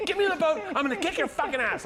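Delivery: high, loud mock threat; Bobby fuming. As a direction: imitate an enraged friend yelling from the water. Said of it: "he's fucking fuming".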